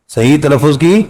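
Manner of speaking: ordinary delivery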